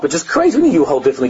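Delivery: ordinary talk, nothing marked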